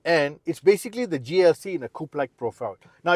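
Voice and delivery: ordinary talk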